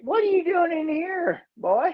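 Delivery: hammed-up farmer questioning a boy; farmer's voice. Voices farmer finding boy in barn.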